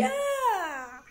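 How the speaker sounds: startled outcry. Retold or imitated. Yelling at officer taking phone.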